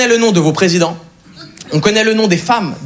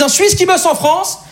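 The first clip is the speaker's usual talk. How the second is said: mocking French onlookers; 7 semitones higher than usual. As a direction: imitate scornful onlookers, pitched up. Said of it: "je vois dans le regard de certains". (raillerie aiguë imitée)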